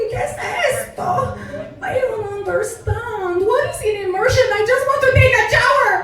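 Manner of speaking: put-on very high, whiny Mexican-accented outburst; Mexican mother, complaining. Mother hates rural Wexford, shrieky Mexican-accented complaining. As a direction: wail every grievance in a hammed-up Mexican accent. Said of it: "before she was like".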